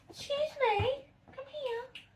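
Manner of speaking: very high, coaxing call to a cat; high little voice for calling the cat; pet-talk voice. Real, not acted. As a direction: pitch it way up to coax a pet over. Calling her cat over.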